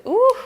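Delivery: high, loud "ooh"; impressed ooh. Real, not acted. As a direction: let out a sudden high ooh. Reacts to powerful cards.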